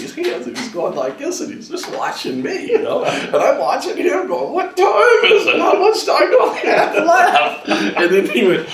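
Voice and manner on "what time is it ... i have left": much higher, loud, slow cries through laughter; mock-frantic cry while cracking up; laughing panic. Panicked question to laughing director.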